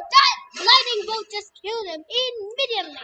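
usual speaking voice

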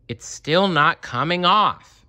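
high, slow grumbling character voice. Acted. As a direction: grumble it out, fed up. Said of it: "she grumbled".

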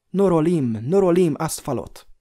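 loud, high ringing elvish command; ringing call. A narrator's reading. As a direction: call clearly to the horse in elvish. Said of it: "érthető, csengő hangon, tünde nyelven". (csengő hangú kiáltás a lónak)

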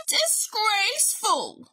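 scandalised bear outburst; barked. A narrator's reading. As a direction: cut in outraged as a sick bear. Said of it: "barked Bear".

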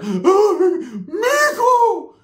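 aunt suddenly sweet and tearful; mock sweet aunt. Aunt calling nephew in a high wail.

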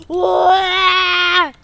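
loud, high "wah" scream; hammed-up scared yell; mock terrified wail. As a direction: wail out loud in play fright. Scared boy cries out.

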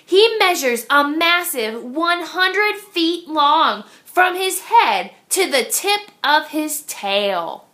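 usual speaking voice

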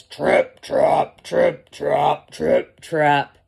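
loud, heavy trip-trap stomps; big goat hoof steps. A narrator's reading. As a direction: stamp out each word like big hooves. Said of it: "he was the biggest billy goat".

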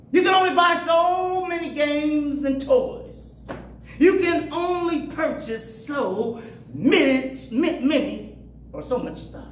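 rising preacher's shout; preaching. Real, not acted. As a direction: build it up like a Sunday sermon.

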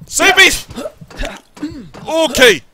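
very high, loud, urgent shouts; panicked high-pitched exclamations; frantic escape yells. Real, not acted. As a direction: call out breathlessly desperate to get away. Escaping the hands in game.

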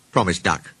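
ordinary delivery, nothing marked

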